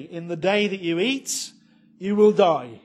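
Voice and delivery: booming, loud divine warning; solemn warning. Acted. Preacher reading God's warning from Genesis, booming. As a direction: proclaim it like God pronouncing judgment.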